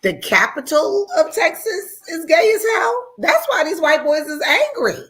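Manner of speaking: high, loud and laughing; cracking up at her own joke; laughing retold remark. Telling friends about Austin, laughing loud. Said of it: "I said".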